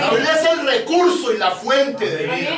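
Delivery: high, slowed, ringing pulpit delivery; emphatic preaching. Real, not acted. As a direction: drive each point home to the congregation.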